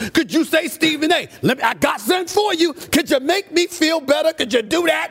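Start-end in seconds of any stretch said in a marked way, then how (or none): 0.7-2.7 higher, eager salesman demo; enthusiastic pitch. Shown as a demonstration. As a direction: sell it with your chest out. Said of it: "could you do it with some enthusiasm".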